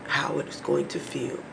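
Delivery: ordinary talk, nothing marked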